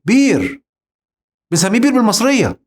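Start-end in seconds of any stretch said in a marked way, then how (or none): 0.0-0.6 بير said bir, without the hamza; Egyptian bir. Shown as a demonstration. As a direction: say بير with a long i, no glottal stop. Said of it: "بنقول عليه إيه". (بير بالنطق المصري)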